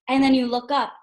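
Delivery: ordinary talk, nothing marked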